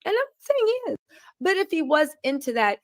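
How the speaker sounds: sarcastic repeat. Real, not acted. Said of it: "sarcastic aside, said again".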